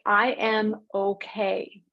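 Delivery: slow, even "I am okay"; slow grounding affirmation. Shown as a demonstration. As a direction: plant each word. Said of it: "you say".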